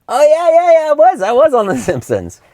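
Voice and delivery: squeaky high, loud offhand brag; mock casual brag. Brushing off friends who ask about IMDB, in a very high, loud mock-modest voice. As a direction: toss it off in a very high, falsely modest voice. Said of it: "and I'll go like".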